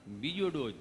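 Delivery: low-pitched, smitten patient; bashful low reply. Acted. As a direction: answer low, charmed by the nurse. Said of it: "તમે કેટલું પ્રેમથી પૂછો છો".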